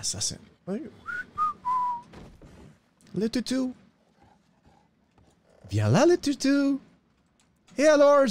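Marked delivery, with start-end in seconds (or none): 5.7-8.2 high-pitched coaxing call; calling a dog. Acted. Man calling a stray toutou over, high coaxing call. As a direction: coax the doggy over in a high voice.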